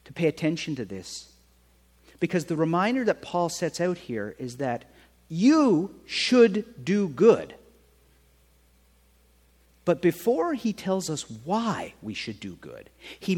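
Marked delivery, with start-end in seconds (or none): 5.3-7.5 slow, loud, every word stressed; preacher spells out the point, very slow; slow emphatic point. Shown as a demonstration. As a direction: drive home you should do good. Sermon point driven home.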